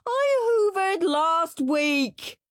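loud, high, drawn-out wail. A narrator's reading. Henry protesting he did chores last week, wailed. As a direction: wail it as a child's slow protest. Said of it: "wailed Henry".

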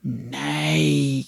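drawn-out, softly voiced no; reassuring voice. A narrator's reading. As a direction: gently dismiss the worry, stretching the word. Mother's voice reassures baker.

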